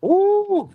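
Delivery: very high, drawn-out ooh. Real, not acted. Panelist reacting to the Mississippi warning, high ooh.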